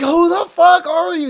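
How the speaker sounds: imitated high, slow, loud challenge; retold yell of baffled outrage; mock indignant shout. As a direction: draw it out as a hollered demand. Retells singer challenging him.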